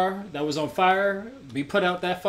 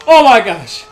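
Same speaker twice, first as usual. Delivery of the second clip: panicked; 16 dB louder than usual. Real, not acted. Loud panic.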